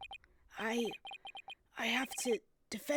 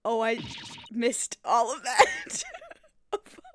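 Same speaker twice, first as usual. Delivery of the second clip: laughing; 8 dB louder, slower than usual. Real, not acted. Laughing at missed dialogue.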